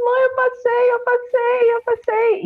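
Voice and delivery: very high excited squeal, imitated; high-pitched mock yelling down the phone; re-enacted screaming. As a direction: relive shrieking the good news to mum. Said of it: "comecei a gritar no telefone".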